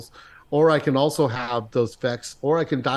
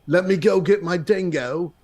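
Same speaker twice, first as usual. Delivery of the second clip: higher-pitched, hammed-up Australian accent; bad Australian accent; 5 semitones higher than usual. Obeying the host's demand to perform, hammed-up Australian accent. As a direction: ham up a shaky-authenticity Aussie accent. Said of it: "Australian accent".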